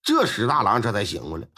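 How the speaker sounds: ordinary delivery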